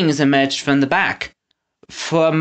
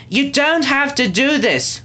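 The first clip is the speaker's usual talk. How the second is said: high, slow earnest plea; pleading; 11 semitones higher than usual. A narrator's reading. Winnie begging the henchmen, high earnest plea. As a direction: beg them to change sides. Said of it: "Winnie pleaded".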